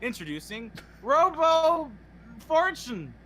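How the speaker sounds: hammed-up salesman projecting; fake ad read. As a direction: pitch it like a parody commercial. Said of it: "time for our fake ad of the day".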